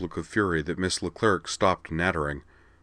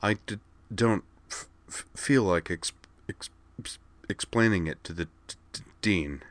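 stammering; 3 semitones higher than usual. A narrator's reading. Kate unwilling to explain it to the dean, stammering. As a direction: catch on the consonants and restart.

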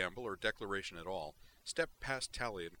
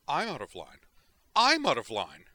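loud, high outraged repeat, growing louder; blazing outrage; 4 semitones higher, 15 dB louder than usual. Acted. Melandra throwing Chen's words back, blazing outrage. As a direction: throw his words back at him, furious. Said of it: "Melandra blazed at him".

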